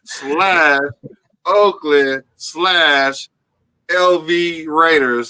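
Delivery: very slow, each name stretched out; slow, drawn-out roll call of names; drawn-out billing. Real, not acted. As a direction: announce it word by word, with long pauses. Drawn-out mock billing of the team.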